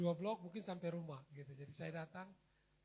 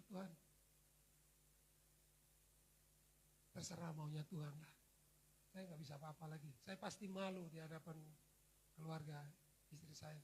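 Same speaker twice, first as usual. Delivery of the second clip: hopeless prayer; 2 semitones lower, 10 dB quieter than usual. Retold or imitated. Despairing inner prayer.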